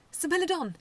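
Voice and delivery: high, loud joyful call; excited. A narrator's reading. Koji running to his bot after winning, excited. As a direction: cheer his name. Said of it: "he exclaimed, excited".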